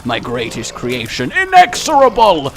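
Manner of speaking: booming villain proclamation, high and loud; mad scientist. Acted. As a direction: announce your monster like an evil genius. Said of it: "spider boss?".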